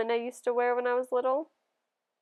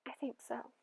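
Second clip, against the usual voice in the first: hushed; 15 dB quieter than usual. Acted. Mother murmuring at night.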